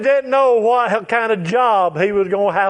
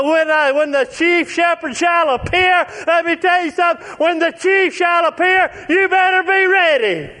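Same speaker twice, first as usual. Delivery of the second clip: higher, shouted preaching climax; preacher shouting a warning to the congregation; preaching at full force; 5 semitones higher than usual. Acted. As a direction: belt it out like a revival preacher.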